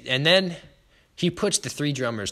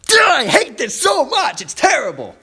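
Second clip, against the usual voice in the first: frustrated shout; 11 dB louder than usual. Retold or imitated. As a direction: shout it high and loud, fed up. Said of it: "you're just like".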